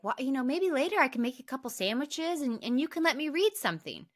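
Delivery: high, light hopeful offer; timid sweet wife. Retold or imitated. Wife offering sandwiches to her busy husband, high and timid. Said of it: "she makes a comment like".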